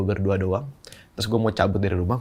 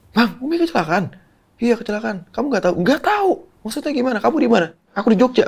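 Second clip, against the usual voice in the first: much higher, frantic phone exchange; retold panicked call; 14 semitones higher, 4 dB louder than usual. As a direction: relive the alarmed call to his brother. (panik menelepon abang, suara jauh lebih tinggi)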